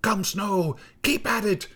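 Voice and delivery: high witchy cry of approval; high, exclaiming storm-witch praise; old snow woman crying out. A narrator's reading. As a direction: cheer them on in a high hag voice. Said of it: "cried the old snow woman".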